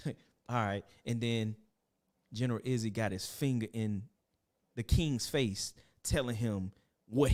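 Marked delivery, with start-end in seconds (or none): none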